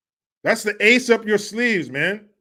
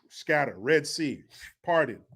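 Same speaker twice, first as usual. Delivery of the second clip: usual speaking voice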